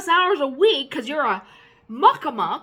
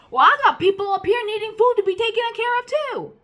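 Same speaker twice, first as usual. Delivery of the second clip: higher, louder indignant comeback; heated retort; 7 semitones higher, 4 dB louder than usual. Real, not acted. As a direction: throw it back at the bosses.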